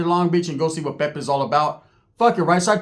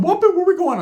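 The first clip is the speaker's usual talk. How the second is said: much higher, eager hype voice; high excited Ricky; 11 semitones higher than usual. Retold or imitated. Ricky jumping into the car, high excited yelp. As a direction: yelp it like an overexcited kid. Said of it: "Ricky jumps in".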